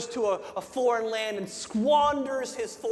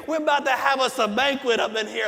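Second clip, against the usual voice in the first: preaching at full force; 4 semitones higher than usual. Acted. Pastor retelling the prodigal son to his church, shouting with fervor. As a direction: preach it big, like you're lifting the whole room.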